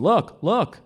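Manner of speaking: high, loud, frantic calling; frantic shouts. A narrator's reading. You faking fright to confuse him, frantic shouts. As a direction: call out in mock fright. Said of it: "the more desperate your calls become".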